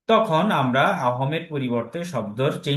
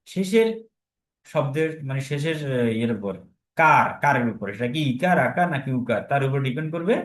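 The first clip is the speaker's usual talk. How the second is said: ordinary delivery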